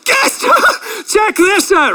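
high mock-triumphant cheer; gloating excitement. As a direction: squeal it like showing off to a crowd, hammed-up. Imagined boasting at own baptism.

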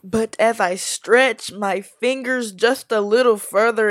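usual speaking voice

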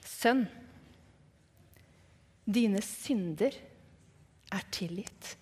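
slow, gentle words, softening to a hush; tender forgiveness. Retold or imitated. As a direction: forgive gently, letting each phrase settle and fade. Jesus kindly forgives the man. (mildt og langsomt, stadig lavere)

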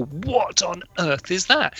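slow, drawn-out wonder; mock amazement at a strange instrument; puzzled children asking; amazed question. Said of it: "people go".

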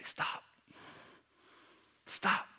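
hushed, urgent whisper of stop; soft pleading whisper; whispered warning. Acted. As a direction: whisper it like a friend pulling you back. Urging a friend to stop.

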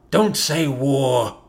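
put-on low, slow old man's spit; an old man bites each word off slowly; crusty grandpa, low and slow; grandpa spat. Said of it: "Grandpa spat".

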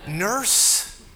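usual speaking voice

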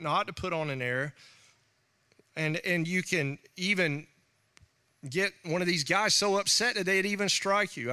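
ordinary talk, nothing marked

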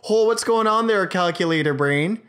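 high, loud mock-islander accent; imitated PEI banter. Guys from a PEI video teasing each other, imitated island accent. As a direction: heckle like a PEI local. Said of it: "these guys that are looking at each other saying".

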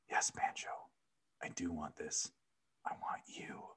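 hushed, whispered lover's reply. A narrator's reading. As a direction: whisper it close and low. Lover's breathy reply in story.

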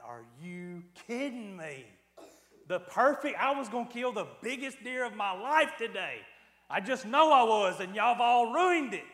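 from stunned to yelling at the hunters; retold outrage. Hunter ranting that the rabbit hunters ruined his morning, half-joking outrage. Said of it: "And I went".

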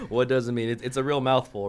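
real amused laughter in the voice; laughing while talking. Real, not acted. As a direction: chuckle your way through the echo. Laughing while echoing the question.

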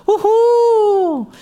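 high, loud mock whoop; crowd cheer. Party guests cheer the announcement with a whoop.

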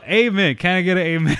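loud call to the congregation; preacher call. Pretend. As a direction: rouse the crowd like a preacher.

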